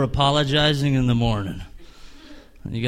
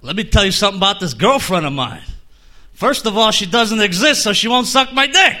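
normal talking voice

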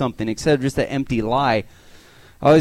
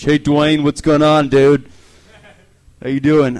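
louder, breezy bro greeting; cheery caller; 8 dB louder, slower than usual. Retold or imitated. Wrong-number caller greets Dwayne on voicemail, loud and cheery. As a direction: boom it like a buddy.

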